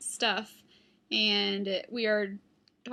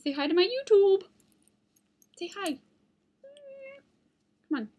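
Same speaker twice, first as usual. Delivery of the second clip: high, loud pet-talk; talking to the dog; 10 semitones higher, 6 dB louder than usual. Real, not acted. Vlogger urging her dog to greet viewers in high pet-talk.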